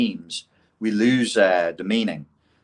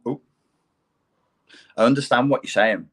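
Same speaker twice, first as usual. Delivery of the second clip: usual speaking voice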